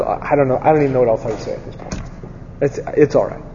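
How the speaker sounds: soft, quick, wounded brush-off; muttered fast, hiding offense. Retold or imitated. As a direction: pretend it's no big deal while hurt. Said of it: "acting like it's no big deal".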